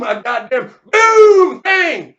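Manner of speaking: slow, high, very loud holler; deafened yell. Acted. Deafened Leonard amid blasts, drawn-out yell. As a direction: bellow it through the gunfire.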